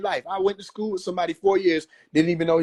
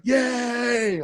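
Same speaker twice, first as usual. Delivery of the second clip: imitating a crowd's cheer, higher; mock cheer; 8 semitones higher than usual. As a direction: do the hands-up yay. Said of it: "everybody gonna point their fingers".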